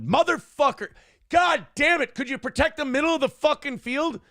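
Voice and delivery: high-pitched outburst at the game; retold swearing rant at the team; angry sports fan. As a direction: yell at the TV in exasperation. Acting himself yelling at the game.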